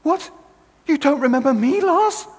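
high, loud, hurt disbelief; rising outrage that he forgot her; indignant disbelief. Retold or imitated. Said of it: "And she said".

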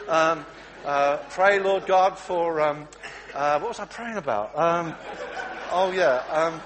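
loud, flustered praying; flustered wife blurting out prayers loudly. Acted. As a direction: scramble to pray after being caught.

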